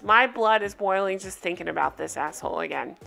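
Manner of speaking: normal talking voice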